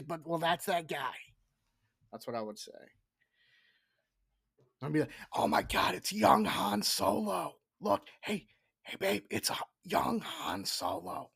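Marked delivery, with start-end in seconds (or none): 5.3-11.3 hushed, excited recognition; excited whisper. Retold or imitated. Nudging his wife in the theater, excited whisper. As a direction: point out the actor in an eager whisper.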